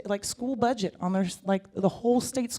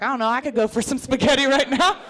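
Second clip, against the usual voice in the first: eager butting-in; 6 semitones higher, 3 dB louder than usual. Retold or imitated. Herself butting in.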